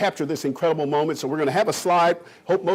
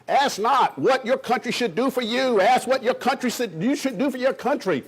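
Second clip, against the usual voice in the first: Kennedy impression; 6 semitones higher than usual. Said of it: "he sounded like Bobby Kennedy and John Kennedy to me".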